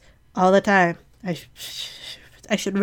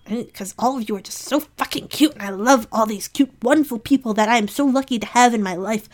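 ordinary talk, nothing marked

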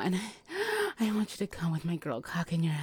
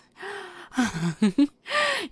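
high, loud giggle of delight; teasing laugh; 12 semitones higher, 5 dB louder than usual. Acted. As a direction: burst into a bright teasing giggle.

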